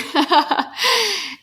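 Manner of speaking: high bright burst of laughter; a quick real laugh. Real, not acted. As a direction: laugh it off lightly. Laughing about nephew's parents.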